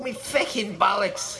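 laughing, slow mock Irish cry. Host copying Dowling's pain, laughing Irish cry. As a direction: laugh through a hammed-up Irish yelp. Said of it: "Yeah, like that".

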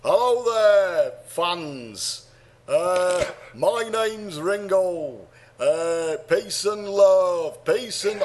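drawn-out, slow Liverpudlian Beatle drawl; [name]. Acted. Podcaster attempting a Beatle impression, slow Liverpool accent. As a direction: stretch each word in attempted Scouse. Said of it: "this is my Ringo impression".

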